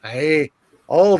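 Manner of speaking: lightly put-on, bad Scottish accent; terrible Scottish accent. Host hails guest Ross from Scotland, terrible mock Scottish accent. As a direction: have a casual go at a Scottish accent, badly. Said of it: "that's a terrible accent".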